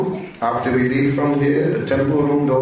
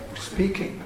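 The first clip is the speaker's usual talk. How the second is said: whispered; 8 semitones higher, 8 dB quieter than usual. Retold or imitated. Startled lady hears a voice.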